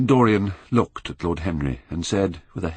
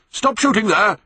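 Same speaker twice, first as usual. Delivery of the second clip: much higher, loud call to the line; shouting at the top of his voice; 6 dB louder than usual. A narrator's reading. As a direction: yell to stop the guns far off. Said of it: "he called out at the top of his voice".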